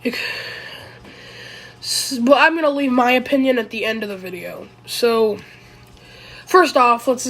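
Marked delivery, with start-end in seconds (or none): none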